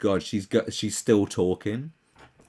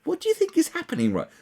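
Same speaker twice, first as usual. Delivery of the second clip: incredulous sarcasm; faster than usual. Retold or imitated. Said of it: "I think".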